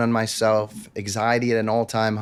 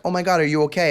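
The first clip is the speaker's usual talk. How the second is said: worried mom; 6 semitones higher, 4 dB louder, much faster than usual. Retold or imitated. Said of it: "my mom is like".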